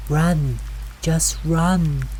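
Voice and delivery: high, mimicked urgent call. A narrator's reading. Mimic voice copying navigator's warning, calling out. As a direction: echo a frightened warning from behind. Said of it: "the voice called out".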